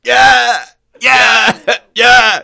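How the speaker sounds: loud, high drawn-out cheer; over-the-top celebratory yell; hammed-up cheering. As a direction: whoop it out like a mock crowd cheer. Mock cheering for a thousand more.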